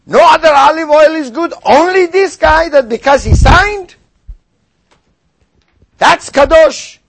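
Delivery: lightly hammed-up priestly pronouncement; mocking the Kohen. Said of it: "if the Kohen doesn't say".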